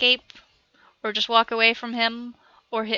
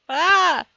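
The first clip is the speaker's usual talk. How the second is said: sudden gasp of delight; 6 semitones higher, 9 dB louder than usual. Real, not acted. Block flips, sudden exclamation.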